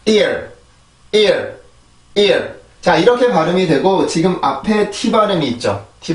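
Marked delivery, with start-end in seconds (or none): 0.1-2.5 "ear" as one falling English syllable; English pronunciation drill. Shown as a demonstration. Teacher reads out the word for learners, drilled "ear". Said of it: "한 번 더".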